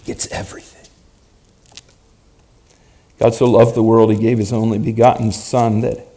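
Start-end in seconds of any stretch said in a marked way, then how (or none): 0.1-0.9 whisper. Real, not acted. Preacher's punchline dropped low.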